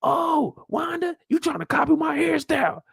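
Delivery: high, mocking comeback; high taunt. Imitating Wanda clapping back, high and mocking. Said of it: "Then she says".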